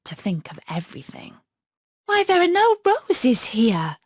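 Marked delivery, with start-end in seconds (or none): 2.1-4.0 high, loud cry of dismay; a little girl suddenly missing the roses. Acted. Gerda crying out when she finds no roses. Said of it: "cried Gerda".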